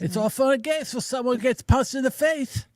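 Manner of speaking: high, lisping Tyson voice; Mike Tyson impression. Host repeats a Mike Tyson quote, high lisping impression. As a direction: lisp it high like the boxer. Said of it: "isn't that a Mike Tyson quote".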